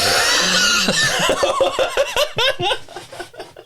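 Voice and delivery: rising whooshing jet engine noise; airplane takeoff. Acted. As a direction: imitate a plane taking off. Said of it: "my impression of an airplane taking off".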